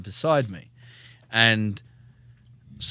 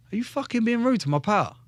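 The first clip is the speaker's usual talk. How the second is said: mock bar confrontation, pitch raised; confronting a rude man; 10 semitones higher than usual. Facing the rude stranger in a bar, higher challenge. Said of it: "I'd be like".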